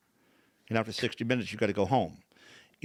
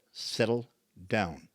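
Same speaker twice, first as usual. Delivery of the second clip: "Settle down" drawn out slowly, word by word; slow, deliberate command; much slower than usual. Retold or imitated. Therapist to frustrated patient, "Settle down" slow and deliberate. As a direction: spell it out calmly: "Settle ... down".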